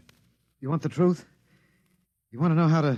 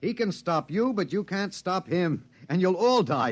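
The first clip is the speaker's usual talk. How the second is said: high, exultant taunt; pitched-up gleeful warning; triumphant taunting; 8 semitones higher than usual. Acted. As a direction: rub it in with glee. Taunting aliens about death.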